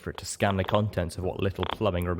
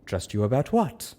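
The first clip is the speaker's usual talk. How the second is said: calm counter-question; 5 semitones higher than usual. A narrator's reading. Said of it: "she replied by asking".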